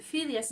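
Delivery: Phidias said FI-dias; stress on first syllable. Shown as a demonstration. Teacher asking how we know the pronunciation, first-syllable stress on Phidias. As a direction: lean on the opening syllable of Phidias. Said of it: "How do we know to pronounce it".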